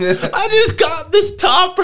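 very high, slow orgasmic cry; orgasmic squeal. Pretend. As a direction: squeal it out in ecstasy. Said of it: "great thing to yell".

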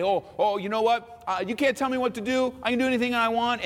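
high, cocky mock defiance; mock defiant. Preacher mimics the defiant lawless person, high cocky mock. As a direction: brush off all rules, cocky.

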